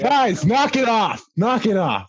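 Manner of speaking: real scolding call. Real, not acted. Host breaks off the podcast to tell others off, scolding.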